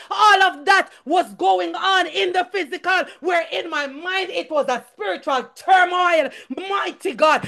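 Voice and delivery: high, forceful testimony; impassioned church preacher; fervent preaching. Real, not acted. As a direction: preach it with mounting intensity.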